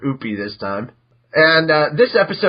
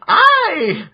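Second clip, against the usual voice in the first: tiny creature chirp, much higher and louder; Happy's chirp; 4 dB louder than usual. Acted. Newborn cat announcing himself to everyone, high, loud chirp. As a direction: pipe it out like a baby cat-creature. Happy answers his name.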